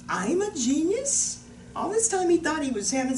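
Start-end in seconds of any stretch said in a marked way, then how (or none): 0.1-1.4 high, slow rising question; wondering little boy. Retold or imitated. Young Thomas hears the news from Mom, amazed. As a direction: stretch it out in awed disbelief.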